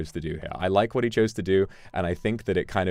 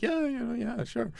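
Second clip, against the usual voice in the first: mock eager agreement; slower than usual. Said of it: "and they were like".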